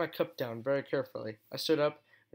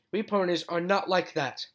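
louder, higher furious hiss; hissed through teeth; 5 semitones higher, 6 dB louder than usual. Acted. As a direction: spit it through clenched teeth. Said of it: "I hissed through my teeth".

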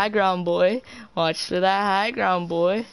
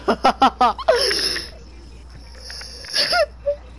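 high gleeful laugh. Real, not acted. Laughing after winning fight.

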